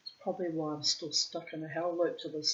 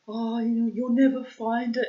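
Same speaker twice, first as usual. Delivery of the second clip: 2 higher, louder teasing singsong; 3 taunt her playfully, pitched up; 1 teasing husband; 6 semitones higher, 9 dB louder than usual. Retold or imitated. 4 husband teasing wife about hidden chocolate, high playful taunt.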